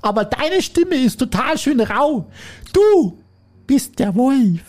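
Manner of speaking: put-on high, slow child voices; squeaky kids calling out the wolf; high slow childish voice; little goats.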